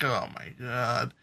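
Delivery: awed sigh. Retold or imitated. Sighing at a smooth talker, slow and soft. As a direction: let it out slowly, half impressed and half exasperated. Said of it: "where it's like".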